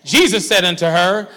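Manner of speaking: usual speaking voice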